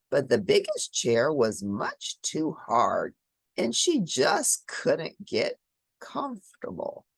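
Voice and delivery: ordinary delivery, nothing marked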